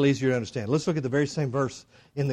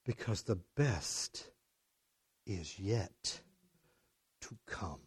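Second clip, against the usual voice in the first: very soft, low and slow; hushed, weighty refrain; 6 semitones lower, 9 dB quieter, slower than usual. Real, not acted. Preacher capping his bigger and better promise, hushed, weighty refrain. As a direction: space it out, sinking almost to nothing.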